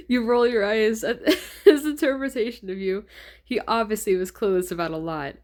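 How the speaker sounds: reading through real laughter; giggling reader barely holding it together; laughing. Real, not acted. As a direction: laugh your way through the narration.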